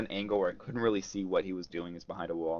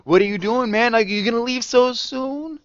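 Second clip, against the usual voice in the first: savage taunt; 8 dB louder than usual. Retold or imitated. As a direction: needle the thief as he leaves. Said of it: "he's being savage".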